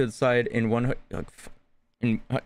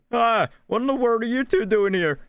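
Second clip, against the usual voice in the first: surprised; 10 semitones higher, 3 dB louder than usual. Acted. Surprised character voice.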